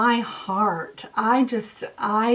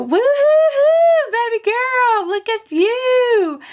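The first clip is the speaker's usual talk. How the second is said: whooping cheer; 12 semitones higher, 7 dB louder than usual. Real, not acted. Cheering the winning subscriber with a loud whoop. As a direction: cheer her on at full voice.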